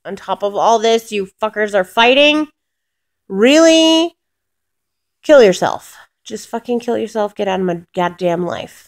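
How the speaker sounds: loud, high, exasperated yelling at the dogs; furious yelling at dogs. Retold or imitated. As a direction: blow up at the fighting dogs. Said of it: "I was so pissed".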